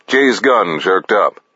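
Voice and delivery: plain reading voice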